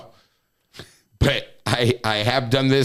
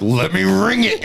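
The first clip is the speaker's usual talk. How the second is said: laughing demand; 6 semitones higher, 4 dB louder than usual. Retold or imitated.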